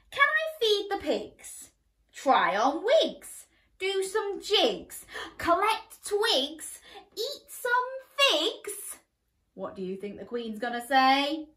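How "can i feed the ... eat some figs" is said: put-on much higher, eager little girl's ask; high hopeful princess voice; pleading girl. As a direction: beg like an excited child. Princess listing wishes.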